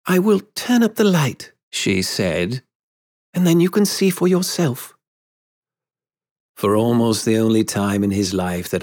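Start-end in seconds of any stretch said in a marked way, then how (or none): 0.1-1.5 high-voiced woman, deliberate announcement; lightly voiced woman, pitched high; grown Wendy. A narrator's reading. Grown Wendy prepares to reveal herself. 3.3-4.9 high-voiced woman, deliberate announcement; lightly voiced woman, pitched high; grown Wendy. A narrator's reading. Grown Wendy prepares to reveal herself.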